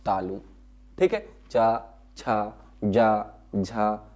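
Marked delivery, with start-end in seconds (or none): 1.5-4.1 चा, छा, जा, झा spoken very slowly, each syllable drawn out; slow syllable demo. Shown as a demonstration. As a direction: demonstrate each consonant slowly for the class. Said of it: "ये जो है व्यंजन हमारे एक ही जगह से". (धीरे-धीरे अक्षर बोलकर दिखाना)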